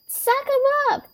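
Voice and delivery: put-on very high shrill kid shout; Tiff voice. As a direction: squeal it like a little girl. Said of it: "Tiff is like".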